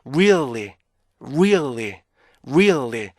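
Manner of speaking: "really" as ree-ul-lee, middle rising then falling; slow three-beat model of "really"; pitch-arc REALLY. Shown as a demonstration. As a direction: lift the hidden "ul" in "really". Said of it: "이 부분이 피치가 잘 올라가야".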